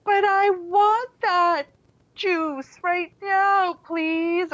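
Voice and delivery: put-on high, slow whining child asking for juice; whiny child. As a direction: beg for it in a nagging whine. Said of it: "in that whiny voice".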